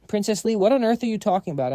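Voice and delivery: high, loud offended outburst; indignant. Acted. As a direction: blurt it out offended.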